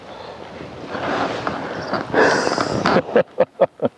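a short, nervous giggle; nervous laugh. Real, not acted. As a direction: laugh nervously under your breath. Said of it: "Gosh, I feel nervous".

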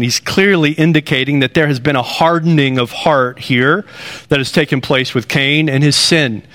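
usual speaking voice

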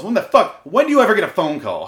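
loud, high-pitched indignant demand; exasperated outburst. Real, not acted. Host ranting at callers interrupting the recording, exasperated. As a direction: burst out in angry disbelief. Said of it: "driving me insane".

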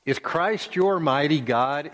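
ordinary delivery

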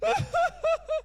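high, stuttering imitation of a child's wail; mock sobbing. Father imitates his ten-year-old crying into her pillow, mock sobbing. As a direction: fake a high-pitched bout of crying. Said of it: "she's crying and it's".

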